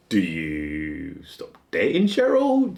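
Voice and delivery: ordinary talk, nothing marked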